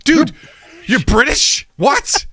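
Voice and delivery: high, stunned disbelief; mock shock. Host reacting to an actor being British, high and stunned. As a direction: react like you just found out. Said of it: "I'm like".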